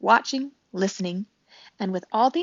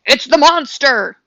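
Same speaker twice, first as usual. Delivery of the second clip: loud, higher screeching alarm; 6 semitones higher, 11 dB louder than usual. A narrator's reading. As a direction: screech it like a startled owl. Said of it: "fluttered up from his branch and screeched".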